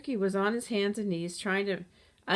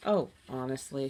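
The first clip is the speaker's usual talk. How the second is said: scowling; 5 semitones lower than usual. A narrator's reading. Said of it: "she said with a scowl".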